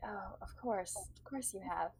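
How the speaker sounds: very soft, near-whispered reply; subdued politeness. Acted. Captain humouring a boastful rival, subdued. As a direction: murmur it politely under the breath.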